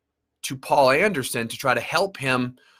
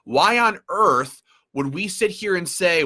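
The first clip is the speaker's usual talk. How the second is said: normal talking voice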